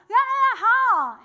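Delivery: loud, high mouth-open sounds; locked-jaw talk. Retold or imitated. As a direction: speak with the jaw stuck wide open. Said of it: "And so I'm like".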